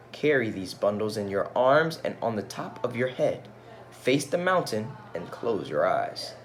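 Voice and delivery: put-on storytelling voice for children; gentle read-aloud storyteller; story narration. A narrator's reading. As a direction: read it like a picture book aloud.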